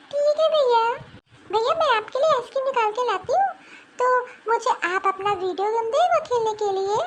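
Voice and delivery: put-on a small girl bargaining sweetly with her big brother; little sister coaxing her brother.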